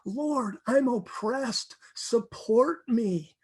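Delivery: slow earnest cry to God; impassioned plea. Shown as a demonstration. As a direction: plead slowly, leaning into support me. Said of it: "This is so powerful".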